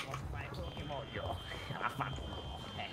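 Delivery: soft unvoiced whisper. Real, not acted. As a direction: breathe it out under your breath. Whispered aside.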